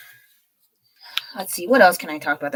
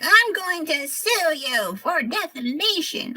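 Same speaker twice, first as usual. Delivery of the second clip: mock influencer; 10 semitones higher, slower than usual. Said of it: "they're like".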